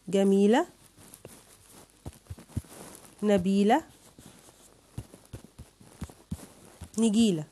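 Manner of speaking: put-on a teacher presenting rhymes to children one by one; stressed rhyme words. Shown as a demonstration. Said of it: "كلمات على نفس وزنها".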